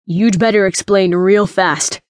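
slow, threatening growl. Acted. Royce recovering from his shock, threatening growl. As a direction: growl it as a warning. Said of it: "Royce growled".

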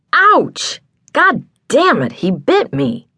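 high yelp dropping into angry cursing; yelp, then curse with all laziness gone; startled pain. A narrator's reading. Man just bitten by a bird, startled and pained. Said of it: "The laziness left the man's voice once he got bit".

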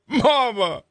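put-on high, wailing man-child; whiny cry for mama. Drunk son wailing to his mother from the cruiser, high. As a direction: wail for your mother.

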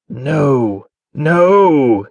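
terrified refusal building to a yell. A narrator's reading. Falcon, lost in terror, yells at his captors. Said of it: "he yelled".

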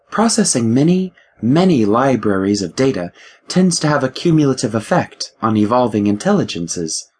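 ship AI voice. Acted. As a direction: play the ship's mind, a touch superior. The ship AI speaks.

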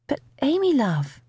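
high, puzzled mum; baffled motherly appeal, starting high; bewildered. A narrator's reading. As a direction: plead in mild bewilderment. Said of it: "sounding utterly bewildered".

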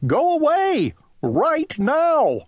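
very high, sharp order to leave; shouting. Acted. Owl driving Winter from the house, shouting. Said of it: "shouted Owl".

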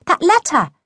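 high, insistent reminder; impatient prompt. A narrator's reading. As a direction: prompt a forgetful friend pointedly. Girl prompts forgetful friend impatiently.

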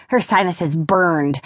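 ordinary delivery

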